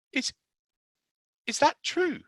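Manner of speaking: high, hesitant small question; boy asking the nurse in hope; timid hopeful boy. Acted. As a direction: ask hoping it's true. Colin timid.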